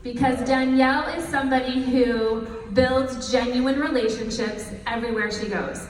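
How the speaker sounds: ordinary delivery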